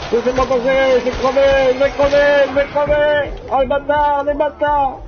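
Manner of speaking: very high, loud desperate cries; panicked screaming. Real, not acted. Gamer trapped and dying, high desperate cries. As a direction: cry out like you're about to die.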